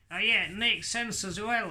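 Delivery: high, squeaky puppet character. Acted. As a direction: voice the cheeky puppet. Puppet Hadley answers.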